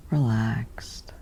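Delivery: ordinary delivery, nothing marked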